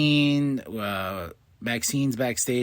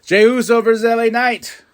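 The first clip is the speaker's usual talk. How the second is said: higher, louder ring announcer call; hyping the match for viewers; hype announce; 9 semitones higher, 7 dB louder than usual. Real, not acted. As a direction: sell the matchup big.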